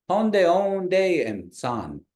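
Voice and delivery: Honde onde high and drawn out, em dropping down; phrase tones. Shown as a demonstration. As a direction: start Honde onde high, fall on em, then san. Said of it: "tone goes down on the aim".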